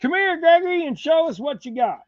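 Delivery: high, excited call to Gregory. Real, not acted. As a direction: sing out to him to come show his stuff. Calling son in to show haul.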